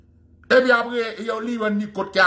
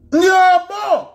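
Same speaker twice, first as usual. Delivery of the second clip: loud exclamation; 11 semitones higher, 6 dB louder than usual. Real, not acted. Emphatic exclamation to audience.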